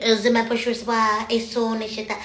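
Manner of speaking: lightly mock French accent. Speaker imagining God arguing in French, mildly mock French. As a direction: put on a bit of French. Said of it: "talking in French".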